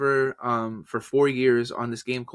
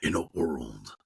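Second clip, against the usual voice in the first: deep, soft trailer announcer; low movie-trailer intro; 8 semitones lower, 5 dB quieter, slower than usual. Shown as a demonstration. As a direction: drop low for an epic trailer line. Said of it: "What if I combine that".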